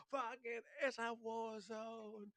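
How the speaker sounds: a high, slow hammed-up cry of disbelief; mock dazed soldier. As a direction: play someone stunned amid gunfire. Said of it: "So it's like".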